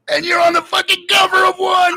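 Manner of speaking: very high, shouted punchline; imitated excited yell. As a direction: yell the big reveal as the buddy. Buddy reveals the big news.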